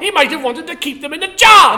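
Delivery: high, loud accusation; hammed-up angry complaint; mock outrage. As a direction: shout the accusation in a pretend row. Mock quarrel with the doctor.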